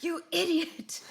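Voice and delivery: high, slow mock chef snap; a hammed-up chef insult. Friend joining the Gordon Ramsay bit, high mock insult.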